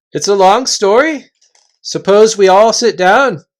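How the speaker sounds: loud, high chieftain calling a halt; gruff invisible chief. Acted. Invisible chief halting the Narnians, loud and high. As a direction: bark the warning from thin air.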